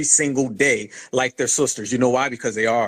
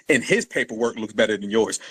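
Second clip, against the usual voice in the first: ordinary talk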